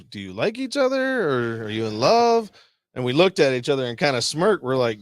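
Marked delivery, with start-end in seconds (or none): 0.0-2.5 high, drawn-out mock-curious question, slowing on love; prying sing-song question. Teller mimicking the sergeant asking if they are in love, high and teasing. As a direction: ham up a nosy boss's question.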